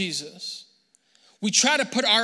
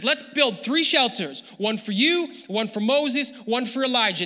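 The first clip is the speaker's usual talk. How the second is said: excited, raised pitch and volume; eager proposal; 6 semitones higher, 5 dB louder than usual. Acted. Peter proposing shelters to Jesus, eager and loud. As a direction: pitch the idea like a thrilled fan.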